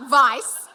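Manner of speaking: vice hit hard and loud; punched word. Real, not acted. Punchline word after stumble.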